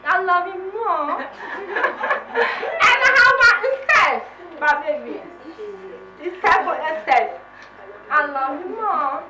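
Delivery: high, loud laughing shout of love; playful loud affection. Real, not acted. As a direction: shout it to your friends, giddy and loud.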